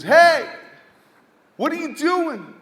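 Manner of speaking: high-pitched shout imitating a bullying girl; retold yell. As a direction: yell "hey" loudly, then stay high for the challenge. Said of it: "she yells out loud".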